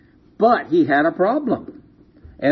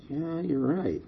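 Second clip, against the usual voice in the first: quiet concession; 9 dB quieter than usual. Retold or imitated. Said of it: "And he thought about it".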